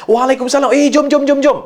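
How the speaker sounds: high, rapid-fire eager yes; match a pal's high, excited energy; mirrored eager tone. Shown as a demonstration. Accepting a friend's futsal invitation, high and eager. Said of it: "kita akan samakan kelajuan suara kita".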